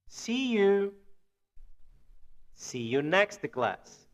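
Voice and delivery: slow, carefully enunciated English phrases; deliberate demonstration with long pauses between phrases; language-teacher model reading. Shown as a demonstration. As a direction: read each phrase slowly for learners to repeat. Teacher reads model phrases for repetition.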